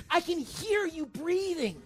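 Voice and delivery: high, slow, drawn-out taunt; menacing imitation. Girlfriend outside the locked door, menacing high imitation. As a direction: imitate a stalker calling through the door.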